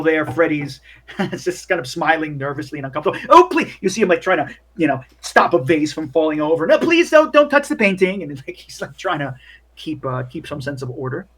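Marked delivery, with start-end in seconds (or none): 3.3-3.7 high, loud fretful plea; nervous bellboy plea. Acted. Bellboy begging drunk party guests, high anxious plea. 6.7-8.2 high, loud fretful plea; nervous bellboy plea. Acted. Bellboy begging drunk party guests, high anxious plea.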